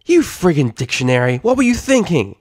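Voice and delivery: loud, high exasperated filly yell. Acted. Scootaloo yelling at Sweetie Belle over the shortcut, loud. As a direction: yell at your friend in panic.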